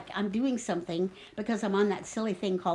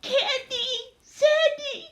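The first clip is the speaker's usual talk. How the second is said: high, drawn calls, getting louder; screamed names; 13 semitones higher, 6 dB louder, much slower than usual. Acted. Trapped girl calling her three companions, high screamed names. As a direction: call each name up the shaft. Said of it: "I scream".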